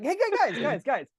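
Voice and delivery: high-pitched, backpedaling pep voice; hammed-up, defensive encouragement; placating rider. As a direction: squeak out hurried reassurance to annoyed teammates. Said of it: "And he's like".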